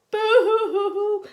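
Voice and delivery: hammed-up pretend sobs; mock crying. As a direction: fake a dinosaur bawling. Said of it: "fall on top of his covers and cry".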